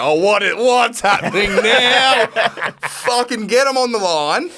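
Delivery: high, loud, drawn-out mock outrage. Driver demanding they fix the fuel price, mock outrage. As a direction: rant it high and loud, stretching the words.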